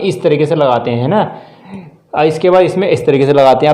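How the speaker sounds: normal talking voice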